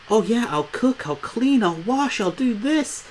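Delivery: bright mock-princess trill, way up high.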